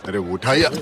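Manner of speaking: loud, urgent urging; impatient shout. Acted. Man urging another to pick up the phone, impatient shout.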